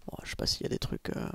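whispering. Real, not acted. Unsure aside while playing.

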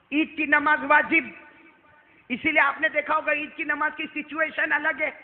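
loud, high, fast rousing sermon; preaching at full force. Acted. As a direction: fire up the congregation, pushing the pace. Preacher at full force on Eid prayer. (زور دار تقریر، تیز اور بلند آواز)

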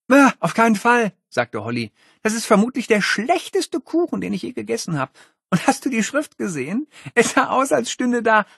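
high, mocking girl's voice; scornful girl. Holly ridiculing the cake to Miles. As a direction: pour scorn on everything.

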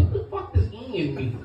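ordinary talk

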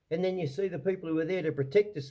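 ordinary talk

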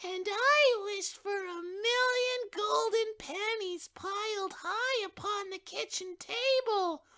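put-on excited child. A narrator's reading. Second child wishing for golden pennies, high and excited. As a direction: squeal out the wish like a little kid. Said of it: "the other child cried".

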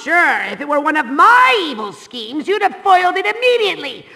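normal talking voice

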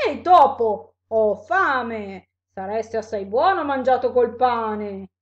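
big, loud monster voice; exclaiming monster. A narrator's reading. Monster eyes the mouse as food, exclaiming loudly. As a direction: boom it out as a hungry monster. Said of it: "Il mostro esclamò".

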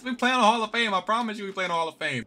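high-pitched, thrilled insistence; excited disbelief. Real, not acted. Gamer boasting about Hall of Fame mode, thrilled. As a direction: swear it's true, voice up high. Amazed at winning on hard level.

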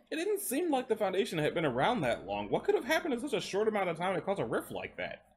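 put-on high, eager young man asking. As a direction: push up high, curious and insistent. Adam's voice in the story.

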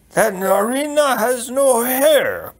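high, slow, loud king; doddering king. Acted. King arriving late with his news, high slow voice. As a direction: draw it out in a high character voice.

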